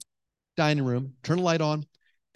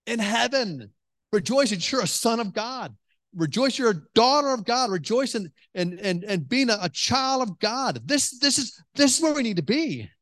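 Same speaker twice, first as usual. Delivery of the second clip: preaching with fervour; 6 semitones higher than usual. Acted. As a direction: lift the congregation, building to a high peak. Preaching builds up.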